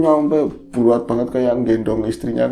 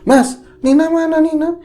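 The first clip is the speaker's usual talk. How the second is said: much higher, urgent, a bit louder; frantic question; 5 dB louder than usual. Retold or imitated. Mother waking her son to find Nina, frantic. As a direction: shake him awake with fear in the voice.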